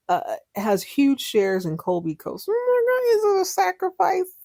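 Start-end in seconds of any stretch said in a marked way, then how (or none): 2.5-4.3 squealed, much higher, with mock disbelief; mock shock. Host mocking the trust fund offer, in high mock shock. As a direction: gasp it out like it's scandalous.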